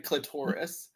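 "clitoris" stressed on TOR; Gottfried's pronunciation. Retold or imitated. Recalling a celebrity narrator, "clitoris" as cli-TOR-is. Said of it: "Gilbert Gottfried called it".

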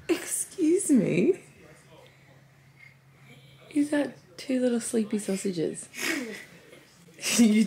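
high, loud, drawn-out mock indignation; slow, high-pitched playful telling-off; playful mock scolding. Real, not acted. Mum finds kids in her bed.

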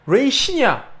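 레이신이야 read as "rei-shin", the wrong reading; 레이신 with a hard "shin" ending; Korean reading of raisin. Shown as a demonstration. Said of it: "R A S I N".

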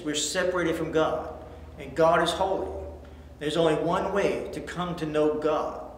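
normal talking voice

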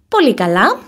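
ordinary delivery

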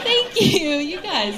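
high, put-on gratitude; mock touched thanks. Comic to her audience after imagined compliment, mock touched. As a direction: play overwhelmed by flattery, high-pitched.

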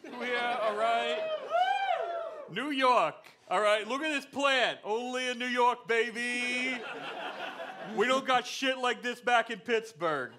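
put-on loud, high-pitched Brooklyn-accented tourist; hammed-up Brooklyn accent, pushed high and loud. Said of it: "New York guy has a Brooklyn accent".

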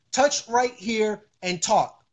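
slow, words set apart; deliberate, word-by-word demonstration; slow patient instruction. Real, not acted. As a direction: spell out each step slowly. Answers doubter by spelling it out.

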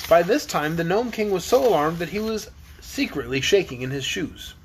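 usual speaking voice

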